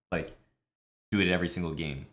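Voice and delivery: ordinary talk, nothing marked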